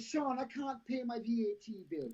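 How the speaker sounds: a self-mocking stab at an old Jamaican woman's accent; crap Jamaican accent. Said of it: "She's my crap Jamaican accent".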